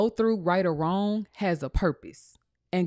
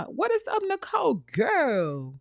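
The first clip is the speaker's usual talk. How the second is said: playful greeting; 8 semitones higher, slower than usual. Acted. Greets a chat member playfully.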